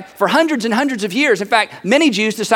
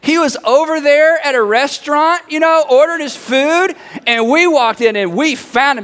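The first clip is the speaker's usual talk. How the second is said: higher, eager news-breaking; excited buddy; 5 semitones higher than usual. Acted. Buddy walks up with news of the Messiah, excited. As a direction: burst in with an amazing sighting. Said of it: "this buddy walks up and says".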